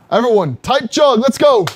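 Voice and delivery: rally the crowd to chug; frat bro. Real, not acted. Hyping stream before beer chug.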